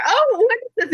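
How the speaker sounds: high, wondering question; curious wonder. Retold or imitated. Medium seeing an old picture book, high wonder. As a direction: ask it in delighted wonder. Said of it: "I'm like".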